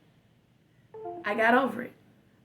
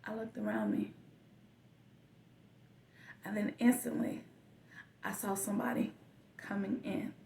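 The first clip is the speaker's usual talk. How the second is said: hushed whisper; 11 dB quieter than usual. Retold or imitated. Retelling the moment underwater.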